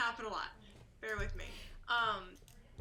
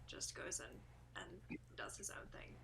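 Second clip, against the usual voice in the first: whispering; 11 semitones lower, 9 dB quieter than usual. Real, not acted. Aside about wandering player.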